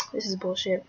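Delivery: muttered whisper under her breath. Retold or imitated. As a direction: whisper it in flat frustration. Frustrated aside while hurting herself.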